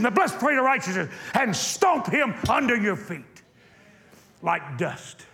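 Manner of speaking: loud, high revival preaching; full-force sermon voice; fiery preaching. Acted. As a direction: preach it out to the whole room.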